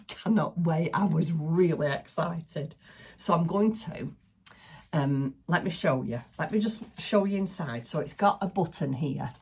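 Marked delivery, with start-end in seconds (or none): none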